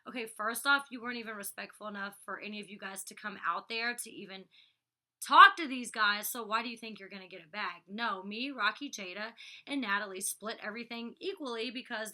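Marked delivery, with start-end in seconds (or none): 5.2-6.3 much louder and slower, hammering it home; loud exasperated slow emphasis. Real, not acted. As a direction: lean hard on every word. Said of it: "ranting at cast mates".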